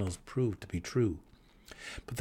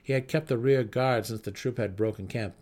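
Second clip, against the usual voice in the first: ordinary delivery, nothing marked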